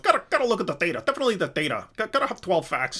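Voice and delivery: quick mock Habermas, hammering the data; Habermas impression. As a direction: rattle it off like an apologetics Rain Man. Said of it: "that's how he talks".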